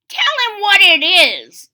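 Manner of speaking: put-on high, loud old-woman voice; old woman suggesting. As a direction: nudge him along like an ancient crone. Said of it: "suggested the old woman".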